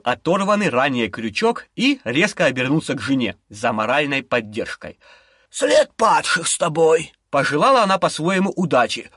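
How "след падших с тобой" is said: slow rasping witch. Acted. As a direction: hiss it like an old witch. Witch wishes husband luck.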